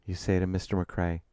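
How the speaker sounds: usual speaking voice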